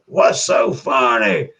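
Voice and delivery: high, slow, shouted what's so funny; Yondu's challenge. Retold or imitated. Retelling a movie, Yondu shouting at the laugher. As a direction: bark it back at the laugher, high and drawn out. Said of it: "Yondu was like".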